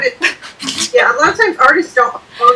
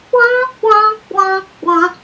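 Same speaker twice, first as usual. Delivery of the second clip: higher "wah wah wah wah", falling away like a sad horn; mock sad trombone; 7 semitones higher, slower than usual. Cohost mocking her own book pick with sad-trombone wahs. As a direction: play a deflating sad trombone with your voice.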